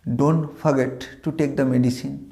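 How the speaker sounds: deliberate teacher's model reading; slow model sentence. Shown as a demonstration. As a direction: read very slowly, word by word. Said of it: "বাক্য হিসেবে বলি".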